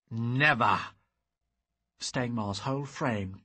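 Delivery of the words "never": defiant refusal. Acted. Hero refuses.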